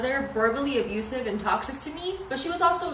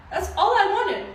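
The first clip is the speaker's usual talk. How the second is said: laughing; 9 semitones higher, 5 dB louder than usual. Real, not acted. Laughing at mom's reaction.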